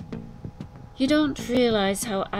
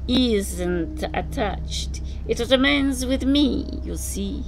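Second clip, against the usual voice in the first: slow, louder, cheerfully smug; 5 semitones higher, 6 dB louder, slower than usual. A narrator's reading. Kadalyi tells Rick he holds the trigger, slow and cheerful. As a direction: drag it out with cheerful menace. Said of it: "Kadalyi said cheerfully".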